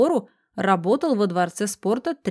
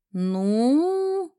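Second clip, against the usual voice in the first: long-drawn word gliding higher; patronizing drawl; 5 semitones higher than usual. A narrator's reading. Police friend weighing a favour for the detective, patronizing drawl. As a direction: stretch it out with lofty condescension. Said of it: "протянул Мельников покровительственным тоном".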